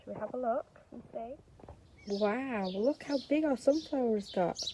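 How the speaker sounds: plain reading voice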